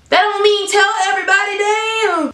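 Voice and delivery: high, quick indignant outburst. Real, not acted. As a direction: protest in a high, scandalised voice. Indignant retort to retold betrayal.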